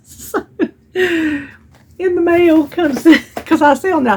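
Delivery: laughing. Real, not acted. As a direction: giggle while telling it. Laughing about gloves in mail.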